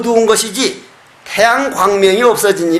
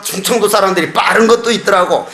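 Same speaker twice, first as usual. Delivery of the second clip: ordinary talk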